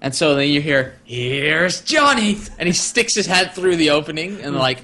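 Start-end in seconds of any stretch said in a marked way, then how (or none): 1.0-2.4 slow, drawn-out mock menace; hammed-up film impression; film villain quote. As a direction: stretch it out like a movie catchphrase. Quotes famous film line.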